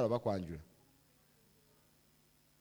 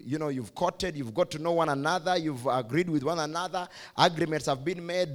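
ordinary talk, nothing marked

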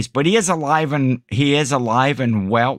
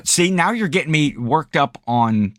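usual speaking voice

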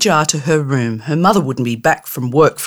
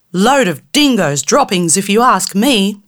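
rude angry fairy; 6 semitones higher than usual. Acted. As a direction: snarl threats through the jar in a tiny high voice. Fairy scoffing.

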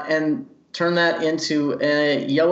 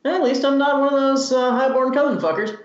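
higher-pitched snide aside; aside into a cup; 8 semitones higher than usual. Acted. Elf retorting into the drink, snide aside. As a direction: toss it into your drink, pitched up. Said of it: "sip my drink and into my cup in Elvish just go".

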